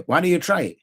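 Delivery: a challenge that means don't you dare; threatening. Shown as a demonstration. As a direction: warn them off with a dare. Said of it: "saying in a threatening way".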